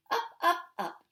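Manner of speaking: father playfully swinging his daughter up; playful swoop. Acted. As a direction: lift the words like lifting a child.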